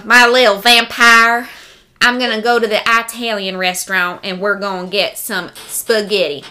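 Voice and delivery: thick Southern accent impression; Miss Allie Lane. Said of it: "Miss Allie Lane".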